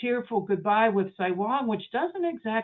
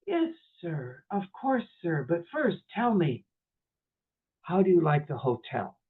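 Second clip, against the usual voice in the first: kindly equanimity. Retold or imitated. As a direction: ask gently, with pauses and total composure. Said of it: "said with such striking equanimity and yet compassion".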